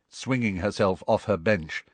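plain reading voice

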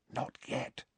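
soft breathy whisper in an ear; breathed whisper. A narrator's reading. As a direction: whisper close and urgent. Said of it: "she breathed in his ear".

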